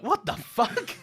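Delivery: high, loud mock disbelief; shocked reaction. Viewer reacting to a shocking scene, mock shocked. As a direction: blurt it like you nearly spat out dinner.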